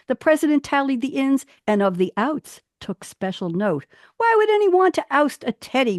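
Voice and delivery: storytelling recital, rising and falling; lilting poem read aloud. Acted. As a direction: read it like a children's verse tale. Reads her teddy bear poem.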